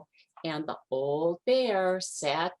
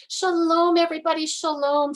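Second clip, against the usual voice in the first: much higher, louder hello; excited greeting; 11 semitones higher, 7 dB louder, faster than usual. A narrator's reading. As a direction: welcome everyone joyfully. Brena greets.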